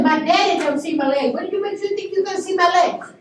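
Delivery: high, indignant rebuke; retold teenager putting him in his place; firm. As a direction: refuse firmly, pitch climbing. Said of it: "I stood firm".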